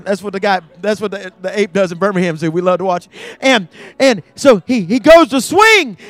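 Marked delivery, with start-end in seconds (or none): 3.4-6.0 high, loud build to the comic disaster; full-force comic storytelling from the pulpit; storytelling preacher. Real, not acted. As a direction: drive the tale to its climax, stretching key words.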